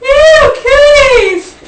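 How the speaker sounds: very high, loud squeal; drawn-out playground yell; mock disgusted shout. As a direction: shriek it in hammed-up revulsion. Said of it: "began to shout".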